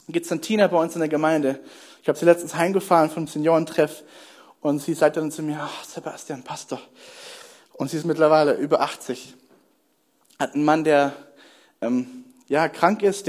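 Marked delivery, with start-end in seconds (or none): 5.6-6.9 low-key, much softer aside; hushed imitation of a resigned reply; soft retold reply. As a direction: quote her quietly, brushing it off. Retold reply of elderly churchgoer.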